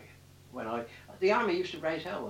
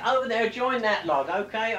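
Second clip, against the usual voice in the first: mock sailor's order; 5 semitones higher, 6 dB louder than usual. Sailor ordering him to join troops.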